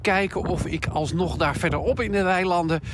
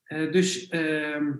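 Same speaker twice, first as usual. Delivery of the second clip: ordinary delivery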